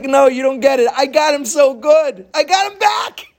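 high, loud triumphant crowing; gleeful. Acted. Beaten little guy telling the crowd, gleeful triumph.

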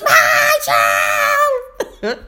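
high, stretched mock holler; mimicked kid shouting back a mantra; imitating yelling student. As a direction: drag out the yell like an overexcited pupil. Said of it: "walking around the room yelling".

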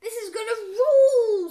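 high, loud eager exclamation; excited boy. A narrator's reading. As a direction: crow it with big anticipation. Excited boy before field trip.

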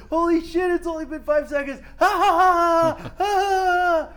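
very high, loud squeal into giddy cackle; stoned amazement. Retold or imitated. Stoned friends reacting to the microwave, high squealing laughter. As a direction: shriek in disbelief, then cackle. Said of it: "'cause we were like".

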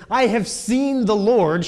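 loud, slow excited announcement; Mary breathless with wonder; freaking out. Retold or imitated. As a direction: burst in with the big news. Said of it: "She's, like, freaking out".

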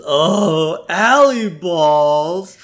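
hammed-up groan of complaint; Homer whine. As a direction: whine it slowly like a sulking kid. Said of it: "Homer just whines".

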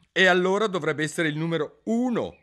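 Alice scoring a point; clever Alice. A narrator's reading. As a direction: catch him out. Alice's logic.

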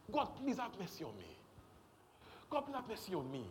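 lightly acted plea for mercy; mock begging prayer. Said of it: "we pray these ṣàánú prayers".

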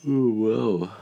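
words through a yawn. Real, not acted. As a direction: stretch it into a yawn. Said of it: "I'm yawning".

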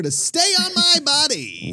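climbing into a high, drawn-out cry; hammed-up high wail; mock exasperated cry. Mock plea about shedding hair.

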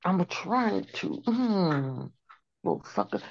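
pained whimpers holding a pet; whimpering through a pet's bites. Real, not acted. Pet biting her nose.

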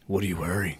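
low mock-sultry phone voice; creepy prank caller. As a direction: tease it out low and slightly hushed. Prank caller playing sexy on phone.

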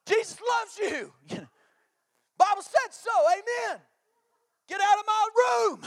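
high, loud hammed-up shout; comic yelling parent. Dad mock-shouting blessings at the kids at bedtime.

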